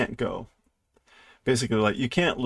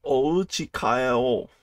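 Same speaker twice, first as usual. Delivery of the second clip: slow cute read; much slower than usual. Shown as a demonstration. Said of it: "Like, let's go home".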